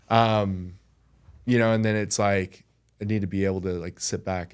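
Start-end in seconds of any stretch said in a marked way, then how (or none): none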